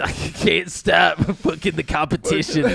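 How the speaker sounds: laughing through the words. Real, not acted. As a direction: giggle while saying it. Laughing joke.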